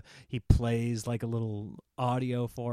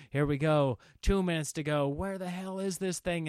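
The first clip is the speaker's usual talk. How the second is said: ordinary delivery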